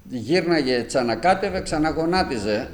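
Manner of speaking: slow preaching. Acted. Preacher tells of his mother.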